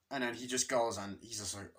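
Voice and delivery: ordinary delivery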